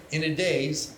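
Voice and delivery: ordinary talk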